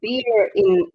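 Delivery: ordinary talk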